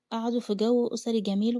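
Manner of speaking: ordinary delivery, nothing marked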